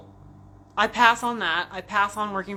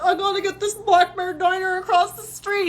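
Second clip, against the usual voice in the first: mock weeping; 12 semitones higher, 6 dB louder than usual. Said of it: "I just started weeping".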